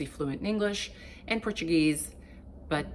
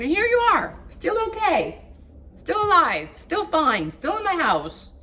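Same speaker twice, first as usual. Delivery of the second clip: much higher and louder, building triumph; rising excitement; 11 semitones higher, 5 dB louder than usual. Real, not acted. Vlogger rallying her viewers, higher and louder with triumph. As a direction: lift each line higher, cheering them on. Emphatic motivational climax.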